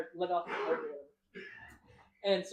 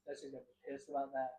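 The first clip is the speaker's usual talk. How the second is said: normal talking voice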